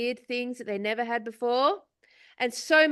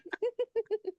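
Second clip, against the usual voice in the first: laughing; 11 semitones higher, 5 dB quieter than usual. Real, not acted. Laughing at own Aussie impression.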